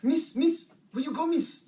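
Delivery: high, loud Kiwi movie quote; imitating a Kiwi character calling after someone; New Zealand accent. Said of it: "I watched Hunt for the Wilder People".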